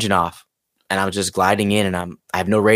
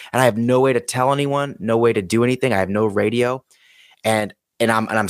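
ordinary talk, nothing marked

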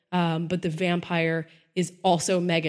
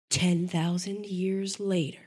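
slow, softer trailer caption read; dramatic trailer caption; 4 dB quieter, slower than usual. Pretend. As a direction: draw out the caption ominously. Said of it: "let me set the scene for you".

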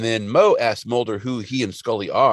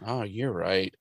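soft sigh, then a quieter concession; resigned agreement; 3 semitones lower, 7 dB quieter, slower than usual. Retold or imitated. Mulder giving in to Scully, mock resigned sigh. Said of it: "Mulder's like".

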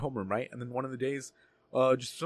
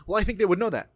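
slightly raised, accusing recital; lightly indignant imitation, higher and a bit firm; administrator quoting him back; 5 semitones higher, 4 dB louder, faster than usual. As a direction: quote his words back as evidence. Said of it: "You said".

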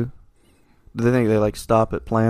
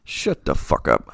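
low mock outrage; 7 semitones lower, slower than usual. Real, not acted.

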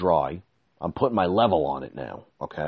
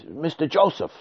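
higher, fast mock-urgent worker; imitating the contractor; 5 semitones higher than usual. Contractor telling him the truck left, higher mock-alarmed voice. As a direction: break bad news in a rush. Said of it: "he says".

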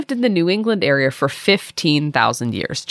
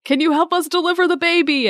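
mock shouting; 12 semitones higher than usual. Crew shouting to the doctor overboard, high mock shout. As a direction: call out in a high pretend shout. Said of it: "They're shouting".

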